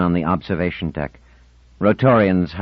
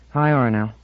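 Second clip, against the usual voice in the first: ordinary delivery, nothing marked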